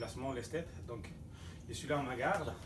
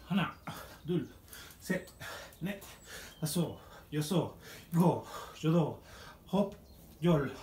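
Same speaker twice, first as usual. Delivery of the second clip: slow coach count; 3 semitones higher, 5 dB louder, slower than usual. Acted. Slowed counting during squats.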